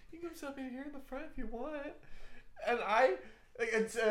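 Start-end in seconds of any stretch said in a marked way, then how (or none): 0.1-1.8 seductive voice. Retold or imitated. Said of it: "in a seductive voice too".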